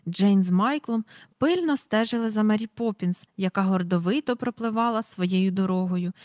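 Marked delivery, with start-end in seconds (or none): none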